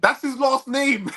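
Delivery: high, loud, drawn-out exclamation; exasperated outburst. Real, not acted. Co-host answers a silly question about cheeks, exasperated outburst. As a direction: pitch it way up in loud disbelief.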